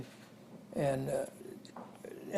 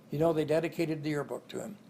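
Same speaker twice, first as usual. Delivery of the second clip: ordinary talk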